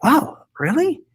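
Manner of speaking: high, slow amazed "Wow, really?"; reacting to the wife's news with slow, high-pitched surprise; retold surprise. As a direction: draw it out in amazement. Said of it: "And I was like".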